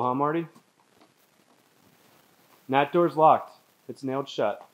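ordinary delivery

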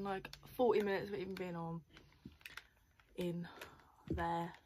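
ordinary talk, nothing marked

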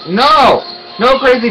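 high, sharp "no"; protesting cry. Real, not acted. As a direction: cry out in objection. Game interferes with the player.

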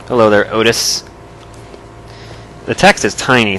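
usual speaking voice

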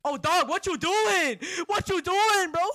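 loud, high, drawn-out protest; startled outburst. Real, not acted. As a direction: yell it in alarm at a sudden intruder. Startled by the game enemy.

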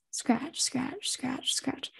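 soft whispered scratching. Acted. Unseen presence scratching at Casey, whispered. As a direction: breathe the scratches out quietly. Eerie scratching sound in dark room.

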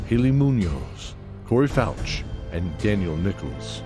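usual speaking voice

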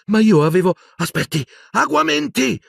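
flustered urgency. Acted. Boy finding the cup empty, flustered urgency. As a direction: stammer in alarm, then cast. Water vanished, frantic second attempt.

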